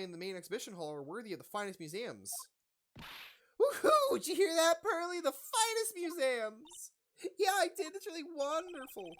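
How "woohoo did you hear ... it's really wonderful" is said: much higher and louder whoop; excited cheer. Acted. Maya thrilled telling Pearly, high excited cheer. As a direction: squeal with delight, stretch the finest museums.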